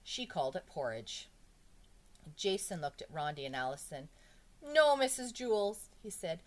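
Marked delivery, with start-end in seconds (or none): 4.6-5.9 high, loud, stretched-out protest; slow, raised cry of dismay; anxious plea. A narrator's reading. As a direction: plead with the teacher. Pupil begs teacher not to leave.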